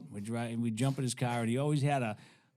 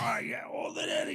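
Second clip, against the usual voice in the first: high choked voice on held breath; imitated smoke-holding. Hippie approving the teen's hit, imitated choked voice. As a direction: keep the air in while talking.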